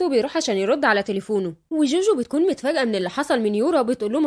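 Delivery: plain reading voice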